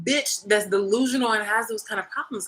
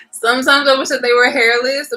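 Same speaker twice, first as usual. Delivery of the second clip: high, loud, laughing delivery; laughing through words; 5 semitones higher, 10 dB louder than usual. Real, not acted. Cat owner wishing the cats were hairless, laughing loudly. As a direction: burst out with it mid-laugh.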